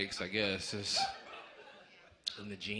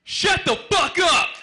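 furious high scream, much higher and louder; angry mom at the movies; 13 semitones higher, 6 dB louder than usual. Retold or imitated. Comic doing his mom yelling at the movie screen, furious scream. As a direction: scream it like a mom fed up with chatter. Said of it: "That's my mom watching a movie".

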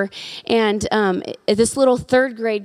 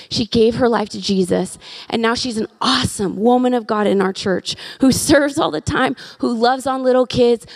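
usual speaking voice

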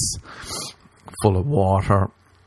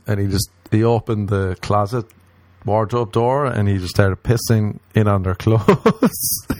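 ordinary delivery

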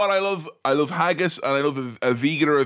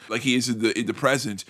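usual speaking voice

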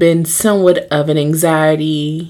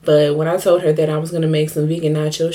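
ordinary talk, nothing marked

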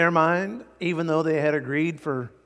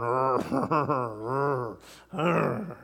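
grumbling growl; much slower than usual. Shown as a demonstration. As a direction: growl like a resentful worker. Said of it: "the sound is something like grrr".

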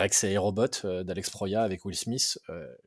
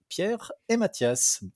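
ordinary talk

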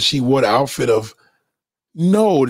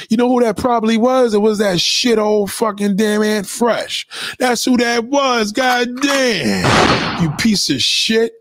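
irritated accusation; 6 semitones higher than usual. Real, not acted. Irritated, naming the suspected troll.

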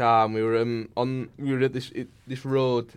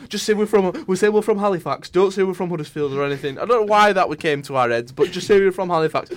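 excited; 6 semitones higher, 6 dB louder than usual. Real, not acted. Excited retelling, raised voice.

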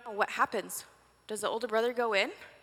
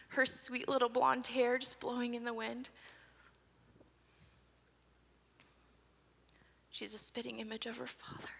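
tender hush; 8 dB quieter than usual. Pretend. Mother gazing at returned daughter.

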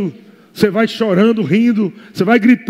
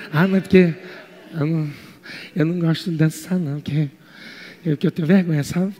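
a bashful believer muttering why they won't dance; much softer, lower, sheepish; shy mumbled excuse; 7 semitones lower, 8 dB quieter than usual. Acted. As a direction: mumble it small and embarrassed, almost swallowing the words.

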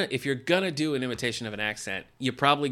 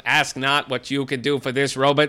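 JFK parody; 7 dB louder than usual. Acted.

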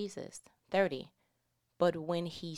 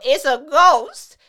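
drawn-out cry, much higher and louder; the disciples cry out in terror at a figure on the water; terrified cry; 9 semitones higher, 18 dB louder, slower than usual. Acted. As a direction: cry out in fright as if seeing a ghost. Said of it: "they cried out".

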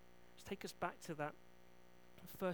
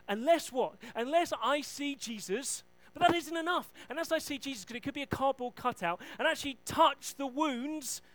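loud, higher, insistent demand; loud doubter; 6 semitones higher, 11 dB louder than usual. Retold or imitated. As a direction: hammer it like a stubborn sceptic. Thomas loudly demanding proof.

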